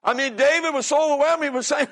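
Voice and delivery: emphatic, high-pitched sermon delivery; fervent preaching. Real, not acted. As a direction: preach it with rising urgency. Preaches with raised voice.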